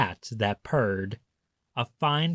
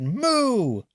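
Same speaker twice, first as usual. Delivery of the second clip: cow moo; 9 semitones higher than usual. A narrator's reading. Reading to children, a high cow moo. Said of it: "And the cow said".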